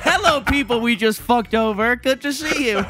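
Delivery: high, laughing fake-friendly greeting; smug dictator. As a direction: gloat like a villain ruler. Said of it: "your overlord dictator".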